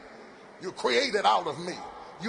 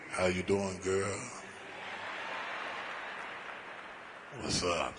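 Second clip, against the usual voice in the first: very deep, softer crooning greeting; voice dropped low; 13 semitones lower, 9 dB quieter, slower than usual. Retold or imitated. Preacher calling Sarita, voice dropped very low. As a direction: go as low as you can, barely breathing. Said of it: "I done dropped my voice down so low".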